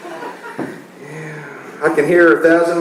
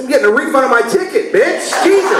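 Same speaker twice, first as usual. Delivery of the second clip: much higher, fast, laughing retort; triumphant yell; 8 semitones higher than usual. Retold or imitated. Husband throwing towels down to claim his refund, high gleeful shout. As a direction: crow it like you've won.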